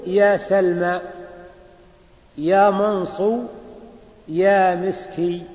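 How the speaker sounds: "يا سلمى، يا منصو، يا مسكي" said slowly, each name cut off at the end; slow teaching demo of "يا منصو" and "يا مسكي"; clipped vocative demo. Shown as a demonstration. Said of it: "فتقول".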